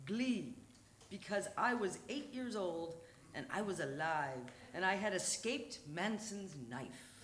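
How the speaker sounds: normal talking voice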